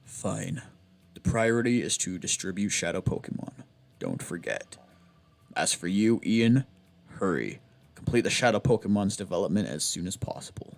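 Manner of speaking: low, close-mic villain voice; deep ASMR-style boss, up close; Nascor voice. Acted. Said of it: "I do that for Nascor's voice".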